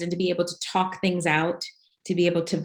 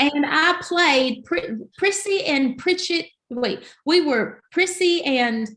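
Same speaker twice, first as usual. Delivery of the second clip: high, drawn-out Southern country accent; slow country twang, pitched up; playful country accent showpiece; 9 semitones higher, slower than usual. Shown as a demonstration. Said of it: "I can do a country accent".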